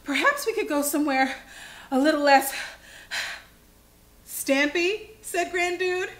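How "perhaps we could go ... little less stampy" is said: acted breathlessness, puffs between phrases; winded storybook speech in broken bursts; panting. A narrator's reading. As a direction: gasp for air between phrases. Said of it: "panted Tom".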